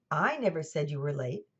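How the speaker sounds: "I" stressed, rest of the sentence unstressed. Shown as a demonstration. Lecturer showing how emphasis changes meaning, stress on "I". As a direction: lean on the opening word "I". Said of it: "which word you emphasize".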